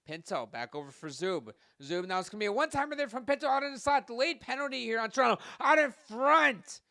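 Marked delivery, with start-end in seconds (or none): 1.8-5.4 much higher, louder and fast, building to the goal; excited goal call. Real, not acted. Goal scored during play-by-play. 5.6-6.8 slow, very loud, high shout; goal shout. Real, not acted. Goal scored during play-by-play.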